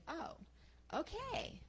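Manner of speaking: high, soft, lifted "oh, okay"; dawning realization. Acted. Woman receiving the bird's advice, dawning realization. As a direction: take it in with a rising voice.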